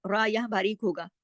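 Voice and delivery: normal talking voice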